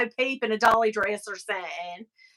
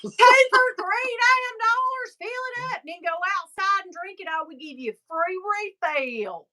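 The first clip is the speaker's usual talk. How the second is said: mock Southern clerk; 7 semitones higher than usual. Playing store clerk.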